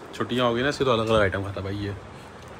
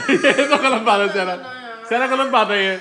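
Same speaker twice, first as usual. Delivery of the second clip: mock scolding; 8 semitones higher than usual. Real, not acted. Mock scolding over a TV fight.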